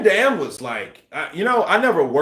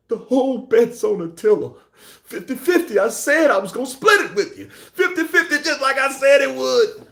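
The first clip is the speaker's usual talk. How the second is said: high, excited crowing; hammed-up film quote, crowing over a bet; gloating movie gambler; 9 semitones higher than usual. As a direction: cry out gleefully like a betting man.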